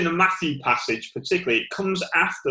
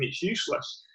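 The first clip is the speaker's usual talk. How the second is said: whispered; 5 semitones higher, 6 dB quieter than usual. Real, not acted. Drops to a whisper.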